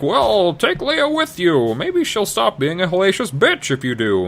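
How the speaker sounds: offload her with a smirk; a hammed-up high-voiced old man; sly Cain. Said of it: "he's like".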